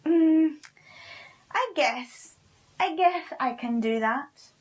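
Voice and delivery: high, loud reluctant witch voice; grudging witch. Acted.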